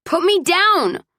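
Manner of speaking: high-pitched indignant demand; indignant protest. A narrator's reading. Woman carried over his shoulder, protesting shrilly. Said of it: "I protested louder".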